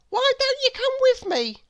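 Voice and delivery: put-on bright childlike schoolboy voice; young William. A narrator's reading. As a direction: pitch it up like an eager small boy. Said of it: "he asked".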